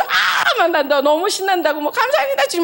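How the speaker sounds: high laughing shriek of delight; shrieking with joy. Retold or imitated. Two friends hearing the pregnancy news, shrieking and laughing. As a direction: squeal and laugh like you can't contain it. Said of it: "우리 둘이서 소리를 꽥 지르면서".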